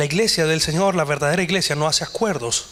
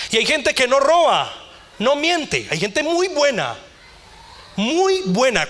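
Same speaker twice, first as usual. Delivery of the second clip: preaching at full force; 7 semitones higher than usual. Acted. Pastor preaching to the church about pride, high and forceful. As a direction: drive every phrase home.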